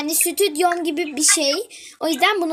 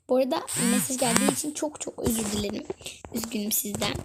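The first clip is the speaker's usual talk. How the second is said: whispering; 5 semitones lower, 8 dB quieter, faster than usual. Real, not acted. Apologizing softly for message sound.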